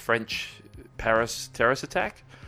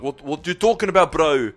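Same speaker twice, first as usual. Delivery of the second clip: loud, higher Bankstown bro voice; Bankstown accent; 7 semitones higher, 6 dB louder than usual. Acted. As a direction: challenge him like a Bankstown bro. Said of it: "normal standard Bankstown accent".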